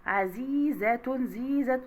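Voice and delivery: slow عزيزة, held on the long ī; long ī vowel. Shown as a demonstration. Teacher showing madd with ya, عزيزة with drawn-out ī. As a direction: stretch the "zī" in عزيزة. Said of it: "يبقى مد بالياء".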